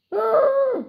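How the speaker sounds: shrill fright cry; loud squeak of alarm; high startled gasp. Acted. Tiger appears.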